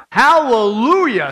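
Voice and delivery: drawn-out, emphatic hallelujah; shouted praise. Acted. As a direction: proclaim it like a heavenly crowd, stretched out. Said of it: "a multitude in heaven shouting".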